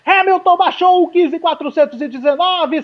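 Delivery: high, loud excited call; excited shout. Real, not acted. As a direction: shout it out as he takes pole. Hamilton takes pole.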